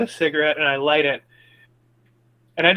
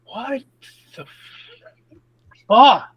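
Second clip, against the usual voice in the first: a high, shocked what, then a loud fuck; stunned disbelief; 8 semitones higher, 6 dB louder than usual. Acted. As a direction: blurt it out in disbelief. Player stunned by the talking crows.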